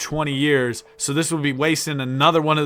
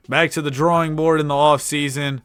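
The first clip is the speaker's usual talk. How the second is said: ordinary delivery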